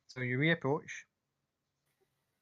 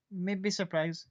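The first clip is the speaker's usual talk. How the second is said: higher, sly "maybe surprise"; teasing evil grin; 7 semitones higher, much slower than usual. Acted. Maka grinning wickedly at Cloud, slyly teasing. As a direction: tease them with a grin. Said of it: "really evil looking grin".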